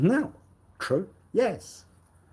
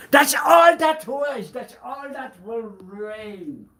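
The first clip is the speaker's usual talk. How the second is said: much higher, loud and slow declaration; grand slow pronouncement; dramatic proclamation; 10 semitones higher, 9 dB louder, slower than usual. Acted. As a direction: boom it out like a prophecy. Whispered line repeated as proclamation.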